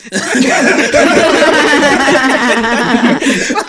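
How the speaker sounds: long, loud, high-pitched laughing fit; big laugh. Real, not acted. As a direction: burst out laughing and keep going. Everyone cracks up at the joke.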